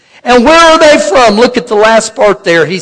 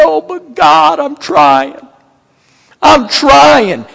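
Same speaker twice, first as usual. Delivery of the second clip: whining; 4 semitones higher, slower than usual. Retold or imitated. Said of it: "whine the blues to God".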